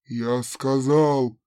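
low, slow threatening command; slow menacing order. Acted. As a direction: drag it out with menace. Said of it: "villain forcing Thane". (медленная угроза)

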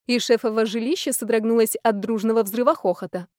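ordinary delivery

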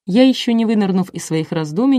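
plain reading voice

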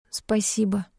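whispered Спасибо with no voice. Acted. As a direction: breathe out Спасибо in a bare whisper. Sore throat, weak thanks.

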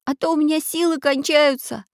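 put-on high-pitched boy's plea; begging. A narrator's reading. Boy tiring in the water, begging his friend. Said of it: "умолял мальчик".

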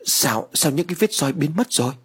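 high, startled cry of disbelief; startled exclaim. A narrator's reading. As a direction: blurt it out in shock. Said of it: "Bà Mỹ liền thốt lên". (thốt lên kinh ngạc)

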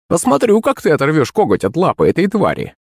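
high sceptical crone voice; challenging old woman. A narrator's reading. Crone doubting he can tear off the claw, sceptical. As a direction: dare him like an old witch.

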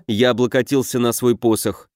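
usual speaking voice

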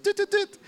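high clicky tut tut tut again; playful mimicked tutting; mock tutting noise. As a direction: click out a little tutting sound. Repeats the same tutting noise.

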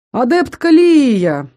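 drawn-out, delighted call of «Лия»; joyful exclamation. Acted. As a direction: greet her loudly, slowly, with delight. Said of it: "радостно воскликнул Дайшин". (радостное восклицание)

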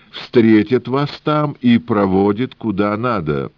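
put-on high, sing-song Yiddish-accented old man; wheedling old trader with Yiddish lilt.

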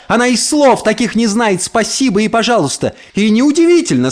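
high-pitched torrent of outrage; indignant tirade. A narrator's reading. Mother berates the neighbour over her daughter, indignant tirade. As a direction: pour it out, seething with indignation. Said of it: "Мама аж задохнулась от негодования".

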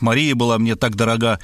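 ordinary delivery

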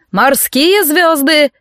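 high, drawn-out command; barked call. A narrator's reading. Trainer calling from the deck to swimmers, drawn-out bark. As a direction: bellow it slowly, stretching the words. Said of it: "рявкнул тренер с палубы".

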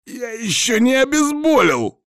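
high, pained angry outburst; cursing through clenched teeth. Acted. Wounded man curses at the woman treating him, high strained yell. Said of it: "ругнувшись через стиснутые зубы".

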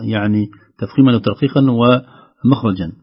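normal talking voice

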